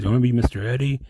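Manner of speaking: ordinary talk, nothing marked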